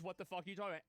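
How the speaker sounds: usual speaking voice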